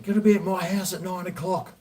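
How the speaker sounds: high-pitched quoted order from a mate; imitating insistent friend. Quoting his friend's insistent invitation.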